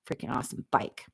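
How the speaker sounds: hushed wistful whisper; whispered aside. Real, not acted. Whispering about the bike no one buys. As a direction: whisper it to herself.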